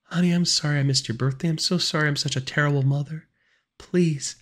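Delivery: high, breaking motherly apology; tearful high-pitched plea; pleading near tears. A narrator's reading. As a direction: beg forgiveness, voice very high. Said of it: "Her voice broke when she started crying".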